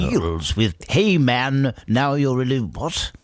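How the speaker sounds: slow, bumbling radio announcer; comically dufferish. Retold or imitated. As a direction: drawl it like a clueless DJ. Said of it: "Just sounded comically dufferish".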